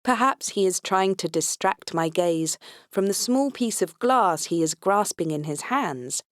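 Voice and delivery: usual speaking voice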